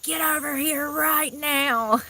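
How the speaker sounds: imitating a mother, high and slow; mother yelling. Mother ordering her bullying son over, imitated high slow yell. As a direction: mimic a mom hollering at her son. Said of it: "She was like".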